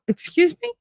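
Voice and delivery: high offended excuse me; affronted surprise. Retold or imitated. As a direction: sound taken aback. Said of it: "it came out of nowhere".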